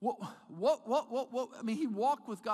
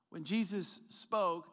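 normal talking voice

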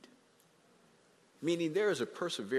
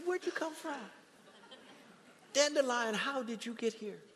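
mock puzzled question; 11 semitones higher than usual. Preacher asking weeds in garden.

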